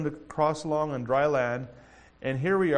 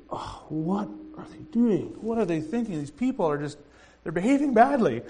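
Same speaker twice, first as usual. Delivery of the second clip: dismayed God; 4 semitones higher than usual. Acted. God musing on his throne.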